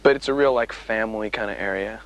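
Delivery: ordinary talk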